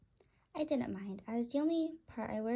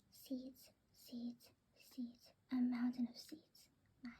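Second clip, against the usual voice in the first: greedy whisper; 6 dB quieter, slower than usual. Acted.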